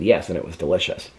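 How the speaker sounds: low, lightly voiced pet; a hint of a smug dog; dog's voice. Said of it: "He said".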